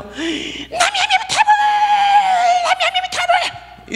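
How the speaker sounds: high, slow hammed-up wailing shout; mock wailing. Imitating a grieving widow at the cremation, high mock wailing. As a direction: cry out like an overdone mourner. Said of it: "이러면서 막 울어".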